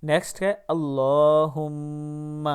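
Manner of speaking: ordinary talk, nothing marked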